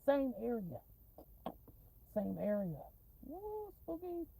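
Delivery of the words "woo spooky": mock spooky. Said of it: "mocking the spooky late-night scene".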